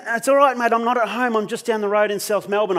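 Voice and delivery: high, pressing comfort; urgent reassurance. Retold or imitated. Troy telling his panicking mate he is near, urgent reassurance. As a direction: reassure urgently, pitched well up.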